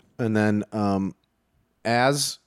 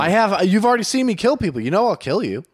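higher, louder menace; robber threatening; 7 semitones higher, 3 dB louder than usual. Acted. Robber threatening the guard in the standoff, loud. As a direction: call his bluff hard.

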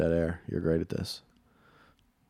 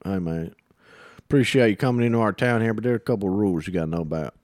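put-on Texas accent. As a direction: welcome them like a Texas local. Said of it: "I can do Texas".

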